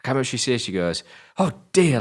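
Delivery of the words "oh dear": high, mocking English accent; weird English accent. Grandmother in the car, stressed, weird English accent. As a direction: put on an odd English accent, pitched up. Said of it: "in a proper weird English accent".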